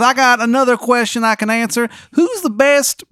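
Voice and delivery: high, loud, bad Maryland accent; Shithead Steve's terrible Maryland accent. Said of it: "he's got a terrible Maryland accent".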